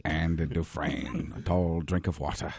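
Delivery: a low, slow prison-film narration; Morgan Freeman. Retold or imitated. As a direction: Shawshank narrator, deep and unhurried. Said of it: "Morgan Freeman".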